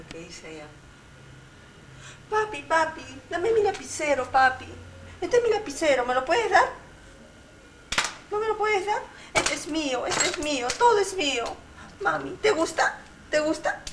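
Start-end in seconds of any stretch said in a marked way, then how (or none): 2.3-13.8 put-on high, loud whiny little girl. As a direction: beg like a spoiled little girl. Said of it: "La niñita que". (voz de niñita caprichosa)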